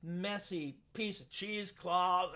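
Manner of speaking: plain reading voice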